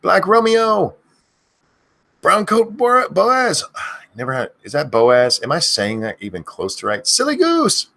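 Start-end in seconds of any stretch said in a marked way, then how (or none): 0.0-3.6 high yelled string of names; screaming names. Acted. Streamer screaming viewers' names in a row, yelling. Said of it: "I end up screaming too much". 7.1-7.9 high piercing yell; screamed name. Acted. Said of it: "I end up screaming too much".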